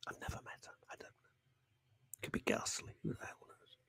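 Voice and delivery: breathy conspiratorial whisper, no voice; whispered aside. Real, not acted. Host whispering an aside about a listener he's never met. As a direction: lean in and whisper it behind her back.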